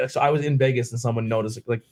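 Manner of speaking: ordinary delivery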